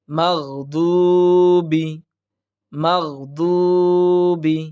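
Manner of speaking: মাগদুবি chanted slowly; Quran recitation. Shown as a demonstration. Teacher reciting the verse for students. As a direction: draw out মাগদুবি syllable by syllable.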